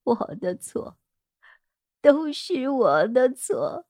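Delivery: tearful, halting admission of guilt; broken, sobbing self-blame; low, trembling voice. Acted. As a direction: draw it out through tears, voice trembling. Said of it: "铃兰的声音低沉而颤抖".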